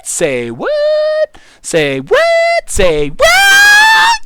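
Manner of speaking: high and building to a shout; hammed-up shocked squeal rising to a yell; mock disbelief. Mock outrage at chat message.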